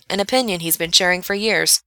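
ordinary delivery

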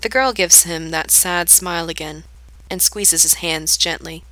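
ordinary delivery

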